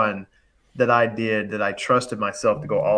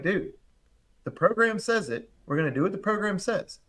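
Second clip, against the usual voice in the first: higher-pitched, insistent retold reassurance; put-on confidence; 7 semitones higher, 4 dB quieter than usual. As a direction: act sure of yourself for a doubter. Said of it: "I had to be confident".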